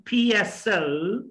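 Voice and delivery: slow P S O as "phee-yes-so"; drawn-out P S O for copying; say after me. Shown as a demonstration. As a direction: stretch and link P S O. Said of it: "try to say after me".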